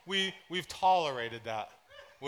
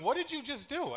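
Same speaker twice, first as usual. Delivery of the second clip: baffled wife; 10 semitones higher than usual. Retold or imitated. Wife reacting to sock removal.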